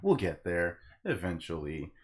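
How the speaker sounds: ordinary delivery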